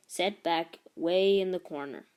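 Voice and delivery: ordinary delivery, nothing marked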